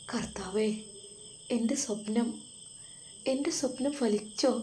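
hushed, then rising high in amazed hope; breathless wonder, the voice climbing on the last words; stunned joyful gasp. A narrator's reading. Mother realizing her daughter's news, hushed and amazed. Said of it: "മമ്മ ഞെട്ടിപ്പോയി".

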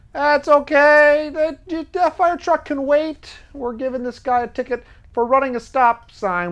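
high, slow mocking cop voice. Host mocking the traffic cops, high and slow. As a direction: ham up a smug cop ignoring a fire. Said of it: "would the cops go".

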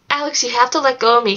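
ordinary delivery

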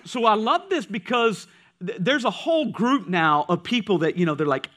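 usual speaking voice

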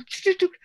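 quick chuffing sound for a sudden impulse; imitated whooshing take-off. Publisher recalling love at first sight, playful imitated rush.

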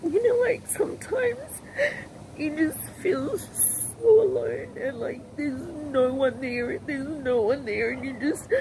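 slow, tearful, breaking voice; crying. Real, not acted. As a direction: drag the words out through real sobs. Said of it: "I don't mean to cry".

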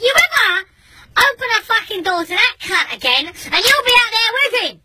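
high, heated ultimatum; angry warning. Retold or imitated. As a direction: warn your wife with rising exasperation.

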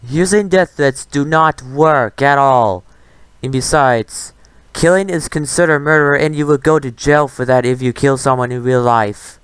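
ordinary talk, nothing marked

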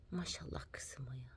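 very soft, hushed admiration; whispered praise. Real, not acted. Praising her girl, the new mother, hushed whisper. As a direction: murmur it proudly under your breath.

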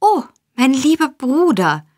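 high, emotional cry of greeting; überschwänglicher Ausruf. A narrator's reading. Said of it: "durch den Ruf geschreckt". (überschwänglich ausgerufen)

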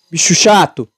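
normal talking voice

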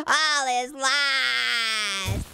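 mock despair. Player stuck below the blimp, mock despair. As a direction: stretch every word into a tragic wail.

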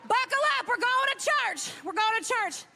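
rally them like a revival; rousing. Real, not acted. Telling the judges to buckle up, a rousing revival call.

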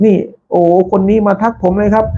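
high, amazed voice; surprised exclaim. Retold or imitated. Chang Tum amazed a commenter came, high surprised voice. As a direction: imitate a pleasantly shocked host. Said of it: "ช่างตั้มบอก".

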